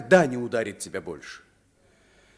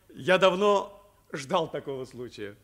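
normal talking voice

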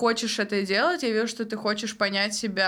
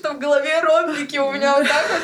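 laughing; 8 semitones higher, 6 dB louder than usual. Real, not acted. Laughing about her long speech.

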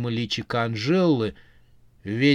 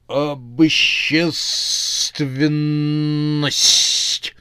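very slow, whispered hiss; zombie hiss; 3 semitones higher, 5 dB louder, much slower than usual. A narrator's reading. Zombie hisses his new hard word to the witch. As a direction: hiss the long word out proudly. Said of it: "прошипел зомби".

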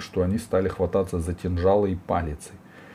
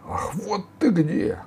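high, drawn-out indignant exclamation; гневный возглас; 13 semitones higher, slower than usual. A narrator's reading. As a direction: pounce on the culprit, stretching the words. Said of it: "разгневался Сила". (гневно, протяжно)

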